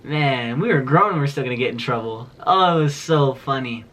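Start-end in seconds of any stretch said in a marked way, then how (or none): none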